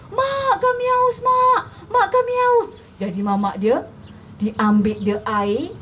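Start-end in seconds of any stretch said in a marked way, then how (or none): 0.1-2.7 put-on high-pitched child's cry to mother; thirsty child wailing. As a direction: wail it high like a desperate child. (suara anak merengek kehausan)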